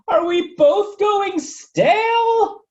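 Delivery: very high, slow, loud shriek; scream. Acted. Pancake screams to Toast in high panic. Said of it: "Pancake then screamed".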